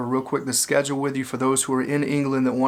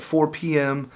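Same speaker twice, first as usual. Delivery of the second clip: ordinary delivery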